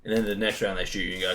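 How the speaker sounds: ordinary delivery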